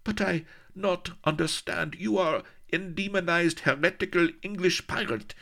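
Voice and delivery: put-on high, accented offended protest; high accented pout; indignant Spanish lady. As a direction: sulk at being ignored. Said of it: "she added indignantly".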